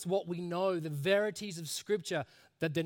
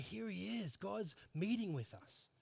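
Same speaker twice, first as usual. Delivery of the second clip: hushed awe; 3 semitones higher, 7 dB quieter than usual. Shown as a demonstration. Preacher shows the tone of meeting with God, awed. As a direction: drop to a reverent hush, stretching the words. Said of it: "a somberness and an awe and an inspiring".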